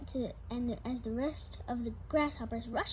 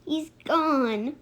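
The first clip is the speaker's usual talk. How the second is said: much louder anguished cry; loud distressed cry; 3 semitones higher, 13 dB louder, much slower than usual. Acted. Sam crying out that his hamster is lost, very loud. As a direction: wail it out in distress. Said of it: "Sam moaned".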